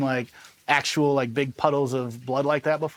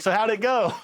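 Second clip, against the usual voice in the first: high-pitched mimicked casual question; imitated chipper question; 8 semitones higher than usual. Saw gunner asking his sergeant afterwards, high-pitched imitation. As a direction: mimic your buddy asking, pitched well up.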